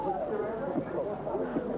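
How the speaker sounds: short amused laugh. Real, not acted. Laughs at own understatement.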